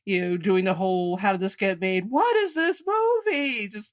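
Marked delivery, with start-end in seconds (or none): 1.2-3.7 railing to the heavens. Retold or imitated. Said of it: "railing to the heavens".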